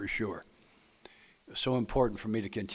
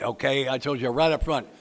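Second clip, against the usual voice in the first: ordinary delivery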